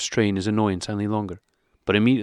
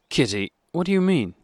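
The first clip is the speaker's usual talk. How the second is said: reproachful; 8 semitones higher than usual. A narrator's reading. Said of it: "he said reproachfully".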